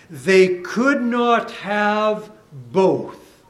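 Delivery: every word of could not have both spaced out slowly; deliberate, weighty preaching line; slow emphasis. Real, not acted. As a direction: drive it home slowly, word by word. Preacher stressing the hard choice.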